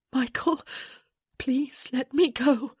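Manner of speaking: high, slow, frightened begging; fearful plea. A narrator's reading. Woman asking the man to release her, afraid. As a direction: plead carefully, high and afraid.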